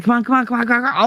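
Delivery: high, rapid mock nagging of "come on"; impatient urging. Mimicking a restless friend, high and pushy. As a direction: hurry them like a restless kid.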